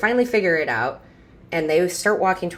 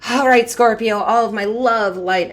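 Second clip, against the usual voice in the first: louder, higher send-off full of love; big warm sign-off; 6 semitones higher, 6 dB louder than usual. Real, not acted. Reader signing off to Scorpio, loud and bright. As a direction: beam the blessing out big.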